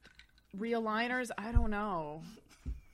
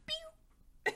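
high popping sound effect; 8 dB quieter than usual. Retold or imitated. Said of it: "they were like".

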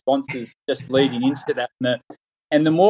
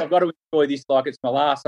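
ordinary delivery

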